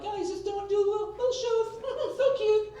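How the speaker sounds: very high falsetto wife voice; mock naive wife. Wife mocking her naive old self, high falsetto coo. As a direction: coo it like a sweet fool. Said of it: "And I'm like".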